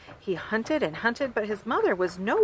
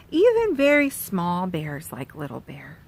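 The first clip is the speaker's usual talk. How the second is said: ordinary delivery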